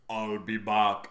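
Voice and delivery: low, slow action-hero catchphrase; catchphrase impression. Damon Wayans trying a one-liner, slow low catchphrase impression. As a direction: drawl it deep like an action hero. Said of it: "Oh, you mean, like".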